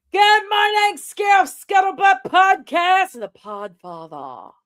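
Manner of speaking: high, loud sing-song hello; cheery shout-out to a chat viewer; big shouted greeting. Real, not acted. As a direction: belt the good morning across the room.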